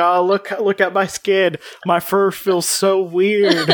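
cat high on ecstasy. Acted. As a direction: play a cat tripping at a party. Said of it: "is rolling on E all the time".

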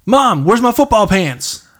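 loud, slow call to the next room; yelling across the room. Retold or imitated. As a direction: shout for mom, drawn out. Calling to mom in another room.

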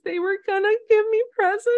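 high, hurried mock sob; hammed-up tearful outburst; acted sobbing. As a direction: blurt it out high, as if breaking down in tears. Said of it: "breaks down sobbing and says".

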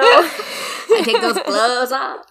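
slow, high, laughing through it. Real, not acted. Repeating friend's glove joke, laughing hard. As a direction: crack up while repeating.

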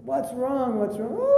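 high, urgent cry of concern; alarmed questioning. Acted. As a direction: play a worried head monk, pitched well up. Head monk questions crying monk.

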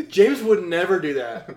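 mock-outraged motherly denial; imitating indignant mom. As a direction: stand up for the child, loud and adamant. Mom indignantly defends other son.

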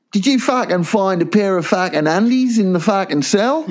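mock a cocky little jail inmate, half laughing.

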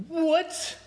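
can't believe it's broken; shocked what. Retold or imitated. To the clinic staff, stunned by the news.

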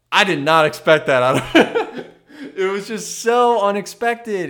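loud, high burst breaking into laughter; amused, astonished outburst; laughing disbelief. Real, not acted. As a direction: crack up while telling it. Laughing at her own story.

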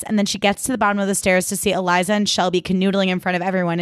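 usual speaking voice

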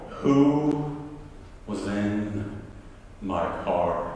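low, very slow, word by word; menacing question dropping low and soft; contained anger. Retold or imitated. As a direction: interrogate slowly with held-in fury. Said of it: "I'm mad now".

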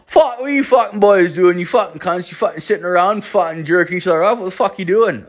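put-on high-pitched, rattled-off Newfoundland accent; [name]'s Newfoundland twang. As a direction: heckle the boys in a mock Newfoundland twang. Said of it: "like, this weird twang".

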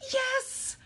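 very high, loud cheer; thrilled kid's cheer; jubilant yes. Acted. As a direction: leap up and shout with joy. Miguel leaps from his seat.

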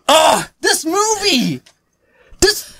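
high, slow, halting exasperation; worked-up, drawn-out frustration; exasperated. Real, not acted. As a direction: plead your bafflement, dragging out each phrase. Exasperated at co-hosts defending film.

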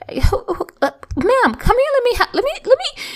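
high, stammered mock outrage; imitated high, sputtering protest; imitating worked-up mom. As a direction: act out a mother ready to burst, pitch soaring. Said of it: "she was worked up and she was ready to burst".

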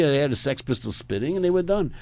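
ordinary talk, nothing marked

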